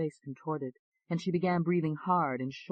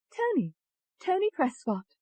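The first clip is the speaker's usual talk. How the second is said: high-pitched English voice; English accent; 3 dB louder than usual. A narrator's reading. Alter ego introducing herself to doctor, high English accent. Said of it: "said in an English accent".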